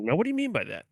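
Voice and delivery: mock interrogator. Host pressing co-host about footlong joke, mock prying. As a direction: press him with a fake serious question.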